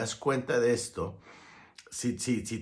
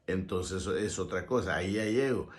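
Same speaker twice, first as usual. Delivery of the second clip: ordinary delivery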